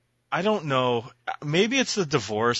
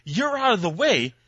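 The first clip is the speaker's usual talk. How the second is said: imagined rant; 8 semitones higher than usual. Retold or imitated. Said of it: "and go".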